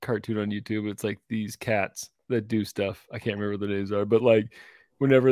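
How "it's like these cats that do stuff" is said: usual speaking voice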